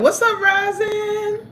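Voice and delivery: high, slow sung-out hello; sing-song greeting. Real, not acted. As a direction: call the name out high and long. Greeting a viewer in chat.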